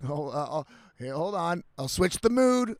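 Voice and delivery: ordinary delivery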